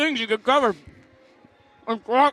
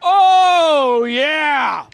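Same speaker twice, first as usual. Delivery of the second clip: loud reaction; 4 semitones higher, 6 dB louder than usual. Real, not acted. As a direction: burst out loud, then drop. Reacting to hot sauce.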